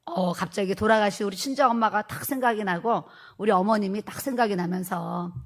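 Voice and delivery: normal talking voice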